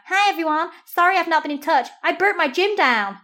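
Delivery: high, loud American vlogger impression; Californian American accent. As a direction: imitate a breezy Californian greeting her followers. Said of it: "like, sort of American in California".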